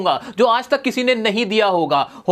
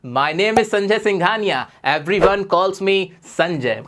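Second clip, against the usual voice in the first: slow model sentence; 2 semitones lower, much slower than usual. Shown as a demonstration. Teacher modelling an English line for students, slow and clear. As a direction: spell it out slowly for learners. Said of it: "तो ये पूरा कैसे बनेगा".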